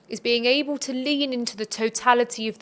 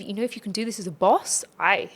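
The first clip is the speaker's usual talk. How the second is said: usual speaking voice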